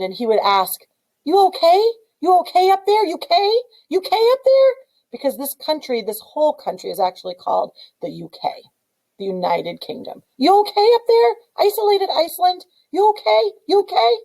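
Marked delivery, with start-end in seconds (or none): 1.2-4.7 worried king. Acted. King worries about isolated Iceland. 10.4-14.2 worried king. Acted. King calls up to Iceland.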